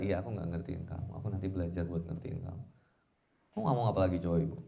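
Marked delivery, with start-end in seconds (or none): 0.0-2.6 low, quick, meek reply; acted husband placating his wife; kind concession. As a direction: soothe by agreeing softly. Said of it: "suami balasnya dengan kebaikan".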